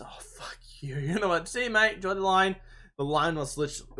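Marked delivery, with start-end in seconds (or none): 0.0-1.0 whispered, much softer mock curse; mate's curse. Mate stuck in the long line, whispered mock curse. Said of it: "And my mate goes". 1.5-2.6 higher, louder gloating farewell; taunting. Retold or imitated. Walking past the friend stuck in line, gloating and louder.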